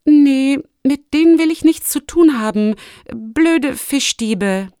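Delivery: loud, high dismissal, slowing on the insult; snorting disdain. A narrator's reading. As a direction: scoff loudly as if you want nothing to do with them. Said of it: "Lumi schnaubte". (laut schnaubend, abfällig)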